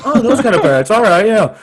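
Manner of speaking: high, loud know-it-all voice; mock parrot expert. Posing as a parrot specialist, high and loud. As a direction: put on a fake expert's confident tone.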